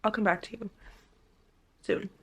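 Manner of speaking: normal talking voice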